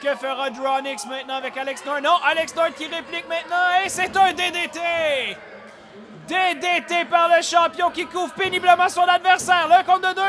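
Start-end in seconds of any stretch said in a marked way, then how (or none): 2.0-10.2 building to a loud high call; rising louder with each count; excited play-by-play. Real, not acted. Champion's comeback, rising call.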